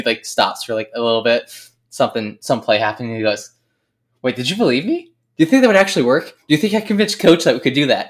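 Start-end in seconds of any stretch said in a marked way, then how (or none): 4.2-8.1 higher, louder, playful teasing; spring the joke with delight; teasing glee. Retold or imitated. Friend revealing the jersey prank to Zach, gleeful.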